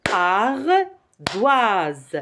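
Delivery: ARDOISE as two separate beats, ar... doise; syllable by syllable. Shown as a demonstration. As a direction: pause between "ar" and "doise" in ardoise. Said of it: "on va taper les syllabes".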